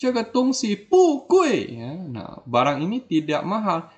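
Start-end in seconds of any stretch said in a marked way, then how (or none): 0.0-1.7 "pu kue" slow and high, "pu" not rising; awkward unchanged tone. Shown as a demonstration. Teacher showing poor reading, "pu kue" high and slow. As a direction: push "pu" high before dropping "kue". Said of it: "agak kurang ya bunyinya".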